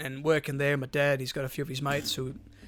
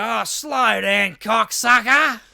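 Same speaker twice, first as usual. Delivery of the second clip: slow, drawn-out shout, higher and louder; mock yell; 7 semitones higher, 8 dB louder, much slower than usual. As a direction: bellow it after the speeding car, stretching each word. Yelling at speeding driver.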